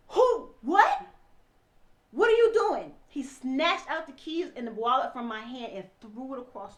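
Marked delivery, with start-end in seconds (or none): 0.1-2.9 loud, high outburst as the husband; infuriated. Retold or imitated. Husband as wife tries to leave, retold infuriated shouting. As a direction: fire off startled, furious questions. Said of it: "He got infuriated".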